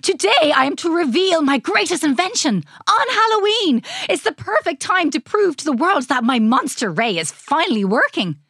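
high, emphatic mad-scientist proclamation; animated exclaiming. A narrator's reading. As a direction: announce it all in exclamation points. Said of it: "speaking almost entirely in exclamation points".

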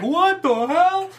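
high, drawn-out disbelief; mock shock. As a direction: act out the stunned players, stretching it slowly. Acts contestants' shocked reaction.

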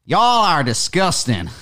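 mock American accent. Shown as a demonstration. Mocking a tractor maker in his American accent. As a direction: put on a Southern American twang, slowly. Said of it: "what's John Deere's accent?".

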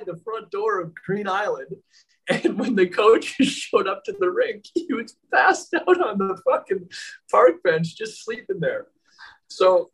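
high-pitched, giggling retelling; can barely hold the laughter in as you tell it; high giggly storytelling between laughs; laughing through it. Real, not acted. Laughing retelling buddy's park bench.

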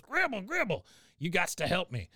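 a high, put-on old man voice begging for help; Cotton pleading. Cotton begging Gribble for help in his basement, high pleading old man voice. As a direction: whine it up high like a panicked old coot.

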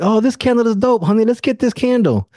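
thrilled, pitching it to honey; husband begging wife for a candle, excited. Retold or imitated. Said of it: "and I was like".

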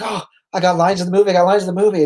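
excited wrestler. Retold or imitated. Wrestler telling the others he got movie lines, imitated excitement. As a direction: gush like a wrestler who just got a part.

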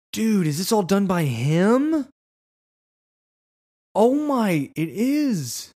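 high-pitched, astonished exclamation; amazed disbelief. Real, not acted. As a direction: gasp it out high, floored by the discovery. Amazed at size of website.

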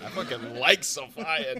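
slow, loud declaration; loud emphatic. Acted. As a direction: belt it out proudly.

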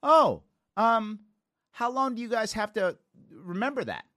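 put-on naive little kid. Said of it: "And I said".

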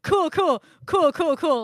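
high, loud, manic cool cool cool; fake-calm cool. Reacting to an underage date, forced cheerful panic. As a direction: chirp it, trying to hide panic. Pretending calm after shock.

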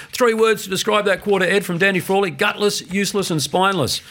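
high, punchy TV boundary report. Retold or imitated. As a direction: deliver it like a live sideline report, pitched well up. On-air report of Danny's words.

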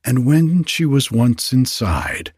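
usual speaking voice

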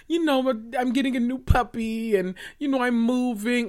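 sugary mock little voice; mocking Sutton. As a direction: sneer at her pretend-sweet excuse. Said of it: "she had all these cute".

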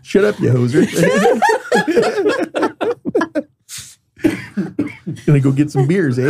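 put-on laughing Canadian accent. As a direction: play it up like a hoser, eh. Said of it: "It's my Canadian voice".

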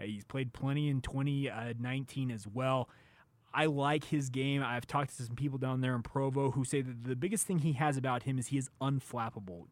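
usual speaking voice

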